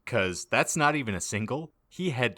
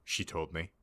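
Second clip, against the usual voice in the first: ordinary delivery, nothing marked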